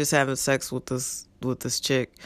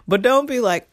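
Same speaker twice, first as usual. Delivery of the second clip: normal talking voice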